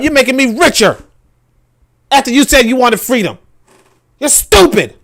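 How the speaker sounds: high, loud gloating taunt; mocking French voice. France taunting Niger, a high loud gloating sneer. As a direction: sneer down at them loudly. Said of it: "They say".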